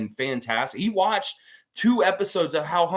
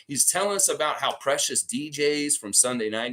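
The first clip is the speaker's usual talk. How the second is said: ordinary delivery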